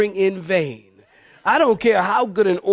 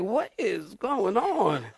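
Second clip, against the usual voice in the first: baffled aside; 8 dB quieter, much slower than usual. Retold or imitated. Baffled aside to wife, acted out.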